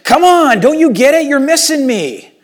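Jesus imagined shaking people, urgent loud plea; exasperated shout. Retold or imitated. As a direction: shake them awake with your voice. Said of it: "grabbing people and shaking them".

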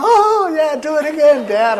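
very high, loud imitation of cheering children; excited kids. Kids to dad after the jackpot, thrilled. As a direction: shout it in hammed-up childish glee.

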